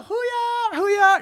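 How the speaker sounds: very high, squealed war cry; mimicked crowd cheer. Audience squad answering the challenge, squealed cheer.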